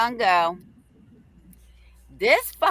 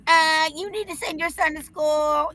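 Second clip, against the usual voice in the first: higher, meek mock phone plea; mocking superintendent; 7 semitones higher than usual. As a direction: play the humbled official begging. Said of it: "that call the next morning".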